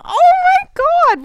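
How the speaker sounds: high, loud, slow shock; overwhelmed by the news; stunned gasp. Retold or imitated. Hearing they are moving to Australia, high and slow in shock.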